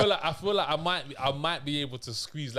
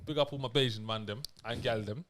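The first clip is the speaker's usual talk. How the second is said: light Bajan accent attempt; 6 semitones lower, 5 dB quieter than usual. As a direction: try out a Barbadian accent. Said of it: "I can try a Bajan accent".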